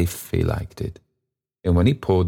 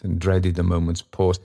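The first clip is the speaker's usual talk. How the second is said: plain reading voice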